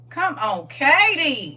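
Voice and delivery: loud call, leaping high on the name; rousing shout. Real, not acted. Preacher cheering on Katie's library news, in a rousing shout. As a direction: holler it out to rally the room.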